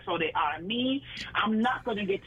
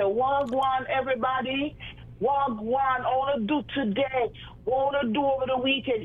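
higher-pitched, lively patois greeting; lifted, rolling Jamaican patois; 6 semitones higher than usual. Shown as a demonstration. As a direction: demonstrate a patois radio host. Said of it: "I do the English and I do the patois".

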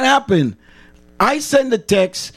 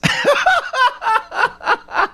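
a high burst of laughter. Real, not acted. Caller show host laughing at own Jamaican demo. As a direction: laugh at your own joke.

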